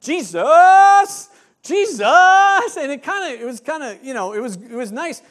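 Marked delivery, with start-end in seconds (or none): 0.0-2.8 high, loud concert shout; crowd shout. Retold or imitated. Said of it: "they would just start shouting".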